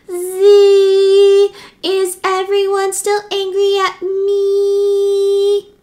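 high, sustained mosquito whine; whining mosquito. A narrator's reading. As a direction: drone slowly on one high note like a mosquito. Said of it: "whining in people's ears".

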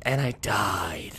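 deflated whisper. Real, not acted. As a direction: breathe it out, defeated. Dead in game, deflated.

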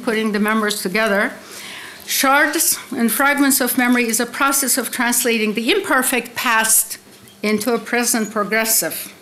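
usual speaking voice

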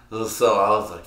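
usual speaking voice

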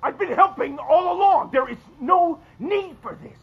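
yelling. Acted. Husband yelling at his wife that he is helping. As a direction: shout him down in frustration. I shouldn't have yelled at you.

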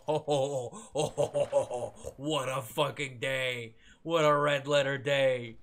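loud, slow gloating with laughter; jubilant, savoring every word loudly; gleeful exulting. Real, not acted. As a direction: laugh big, then draw out the delight. Gleeful after lucky grenade find.

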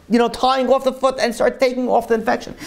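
ordinary delivery

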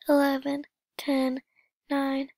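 ordinary talk, nothing marked

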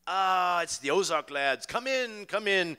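a bright, bad English accent as a host welcomes guests. Paul welcomes the band into his dressing room, bad English accent. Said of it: "Pretty bad English accent, wasn't it?".

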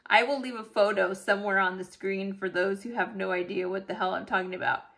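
ordinary delivery

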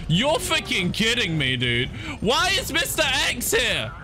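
high-pitched panicked disbelief; a frightened player, voice jumping up in panic; real panic. Real, not acted. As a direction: squeal it out as the stalker closes in. Mister X appears, panic.

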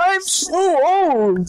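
put-on high, slow old person voice. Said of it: "I just like doing old people voices".

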